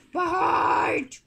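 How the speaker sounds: loud, high outraged yelling; dramatic scream of outrage; indignant shouting. Pretend. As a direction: yell in hammed-up betrayal. Yelling at teammate who left.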